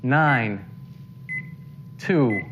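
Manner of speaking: ordinary delivery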